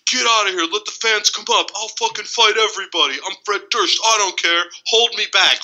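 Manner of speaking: high-pitched mock tirade at security. Podcaster retelling a singer's onstage meltdown, mock angry yelling. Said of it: "[name] is on stage yelling at security".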